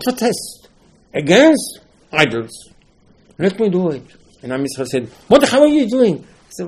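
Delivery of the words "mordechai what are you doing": challenging shout. Retold or imitated. People challenging Mordechai.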